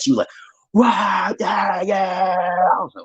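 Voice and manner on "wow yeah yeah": higher, shouted mock outrage from the mother; mad mother. Angry mother yells at her daughter in mock outrage. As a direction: play the furious mother yelling. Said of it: "the mother got so mad".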